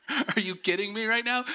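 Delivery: high incredulous disbelief; can't believe what you see; exasperated. Retold or imitated. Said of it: "I'm like".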